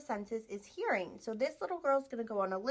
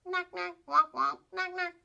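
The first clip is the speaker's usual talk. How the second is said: higher playful quacking; small duck quack; 7 semitones higher, 4 dB louder than usual. Shown as a demonstration. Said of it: "They say".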